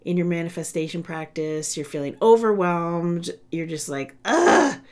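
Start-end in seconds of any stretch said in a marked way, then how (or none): 4.2-4.8 frustrated ugh. Retold or imitated. Voicing a frustrated listener.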